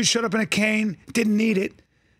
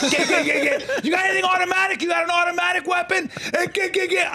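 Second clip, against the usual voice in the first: frenzied hunter; 9 semitones higher than usual. Retold or imitated. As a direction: egg yourself on while shooting birds. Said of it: "I was like".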